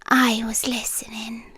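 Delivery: breathy creepy whisper. Acted. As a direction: let it slip out as a secret. Creepy confession to tenant.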